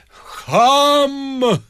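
a high, sharp shout; the locksmith yelling after the procession; shouted insult. Acted. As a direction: fling the word after them. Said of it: "закричал Виктор Михайлович".

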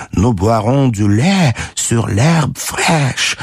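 slow, spiteful French; contained, bitter spite; savage malice. A narrator's reading. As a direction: draw out the French with venom. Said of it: "прибавил де Грие со зверской злобой".

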